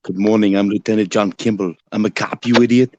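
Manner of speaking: lightly voiced movie action-hero impression; hint of an Austrian action star; Arnold Schwarzenegger. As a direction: play a tough cop with an Austrian accent. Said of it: "You sounded just like him".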